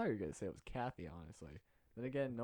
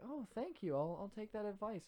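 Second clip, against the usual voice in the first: put-on much higher, grateful girly voice; gushing thanks; 11 semitones higher than usual. Student teacher takes his advice, high and grateful. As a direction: thank him brightly. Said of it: "she's like".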